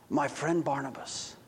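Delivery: saddened. Acted. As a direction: say it heavy with dismay. Zacchaeus saddened.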